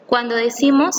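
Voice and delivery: ordinary delivery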